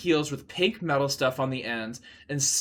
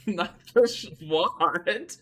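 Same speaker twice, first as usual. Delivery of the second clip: a laugh breaking into the correction; laughing at a slip; 4 semitones higher, slower than usual. Real, not acted. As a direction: giggle through the fix. Reader laughs at own slip.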